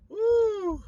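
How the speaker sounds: loud, high whoop of relief; excited whoop. Real, not acted. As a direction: let out a loud, high "woo!". Vlogger reacting to hard yards.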